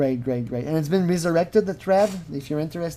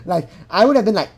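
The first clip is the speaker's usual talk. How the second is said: usual speaking voice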